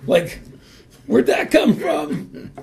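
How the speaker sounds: high, loud and slow with disbelief; mock amazement. Hockey captain marvelling at his own polite words, high and loud. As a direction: shout out his own astonishment.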